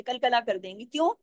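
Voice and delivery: ordinary delivery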